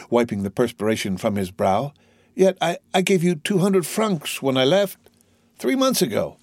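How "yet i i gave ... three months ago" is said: put-on high, faltering young man; stammering dismay. A narrator's reading. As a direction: stumble over the start, bewildered. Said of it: "stammered Dante".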